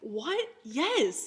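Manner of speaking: mildly hammed-up irritation; retold irritation. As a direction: imitate an exasperated fiancé. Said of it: "Like, irritated now".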